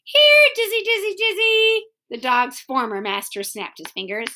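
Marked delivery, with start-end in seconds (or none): 0.0-1.8 high, loud, sing-out dog call. Acted. Former master calls Dizzy in the contest, calling out. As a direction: ring it out to summon the dog.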